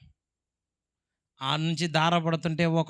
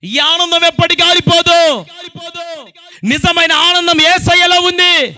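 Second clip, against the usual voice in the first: much higher and louder proclamation; full-force preaching; 13 semitones higher, 16 dB louder than usual. Acted. Preacher proclaims joy in Jesus to the congregation, loud high fervent preaching. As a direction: ring it out to the whole congregation.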